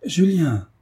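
high, joyful call of a friend's name; glad cry. A narrator's reading. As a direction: cry out his name in delight. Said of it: "s'écria le beau rêveur".

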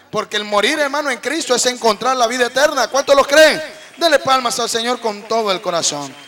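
loud preaching call; full-force preaching. Real, not acted. Preacher calling the congregation to clap, full force. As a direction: rouse the congregation to praise.

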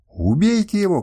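furious princess demanding his death; shouting. A narrator's reading. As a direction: shout the order at the guards. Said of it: "кричала она".

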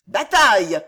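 loud, full-voiced yell; battle cry. A narrator's reading. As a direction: roar it like charging guards. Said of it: "hurlèrent les gardes". (hurler un cri de guerre)

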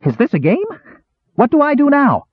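high eager squeal; highly excited. A narrator's reading. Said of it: "highly excited".

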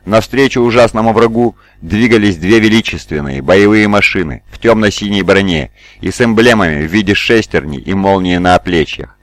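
ordinary delivery

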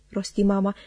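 ordinary delivery

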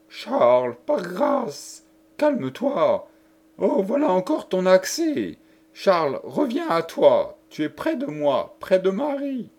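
a woman pleading. Acted. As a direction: beg him to come back to himself.

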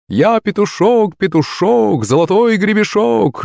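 high, slow, drawn-out rooster chant; showy high-pitched mascot holler; crowing cry. A narrator's reading. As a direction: crow it out like a costumed cockerel. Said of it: "закричал он".

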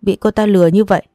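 ordinary delivery, nothing marked

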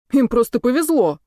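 a touch of sulky grumbling; grumbled retort. A narrator's reading. Kirill at the edge of the court, grumbling. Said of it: "буркнул Кирилл".

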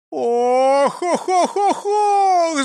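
high, loud wail of "oh"s; theatrical moaning cries; loud lamenting. A narrator's reading. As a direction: wail in rising, hammed-up woe. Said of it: "запричитал он".

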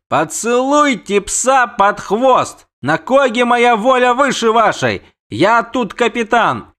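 high, loud captain's retort; defiant anger. Acted. Captain asserts command over the prince, angrily defiant. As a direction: hurl it back, stretching the words.

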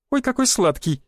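high-pitched coquettish cooing; giggly flirt. A narrator's reading. Camp woman cooing over the newcomer, giggly and flirty. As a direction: coo it high and playful, like a teasing floozy. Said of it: "хихикнула потрёпанная шлюха".

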